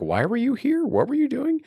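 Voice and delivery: high-pitched imitation of an interrogation; pressing mock questioning; grilling. Cops demand answers from Craig, grilling.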